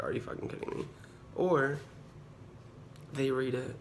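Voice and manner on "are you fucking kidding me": muttered disbelief. Retold or imitated. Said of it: "and I'm like".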